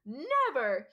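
Hagrid's booming outburst; thundered. A narrator's reading. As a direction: thunder the word out like a giant. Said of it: "he thundered".

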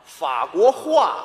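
法国话 said slowly as fa guo hua; slow correction. Shown as a demonstration. Student patiently correcting the teacher, slow distinct 法国话. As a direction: separate each syllable of 法国话 clearly.

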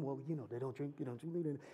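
a mock whisper behind their hands; whispered gossip. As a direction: murmur it as if the others mustn't hear. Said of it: "you just see the whispers in the room".

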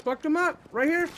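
high, loud excited call; combat callout. Real, not acted. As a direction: yell it out mid-fight.

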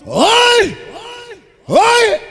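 sharp call. Real, not acted. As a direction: cut through the music with a call. MC calling out.

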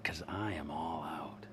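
sarcastic unvoiced whisper. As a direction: hiss it under your breath, mock-wounded. Sarcastic whisper to old friend.

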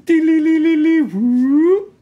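high chirpy imitated alert jingle; singsong alert noise. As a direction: chirp it like a notification chime.

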